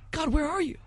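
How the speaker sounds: a crucified man crying out, betrayed; anguished cry to God. Retold or imitated. Debater voicing Jesus on the cross, high anguished plea. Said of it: "Suddenly he says, Oh my God".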